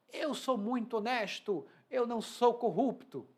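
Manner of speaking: high, slow boastful politician; high, drawn-out claim of honesty; smug politician. Acted. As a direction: play the self-righteous politician.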